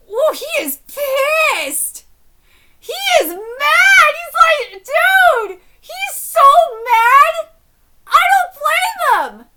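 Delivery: high, loud, drawn-out exclamations; real worked-up fan outburst; hyped shouting. Real, not acted. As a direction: stretch each key word in a stunned yell. Hyped reaction to character's anger.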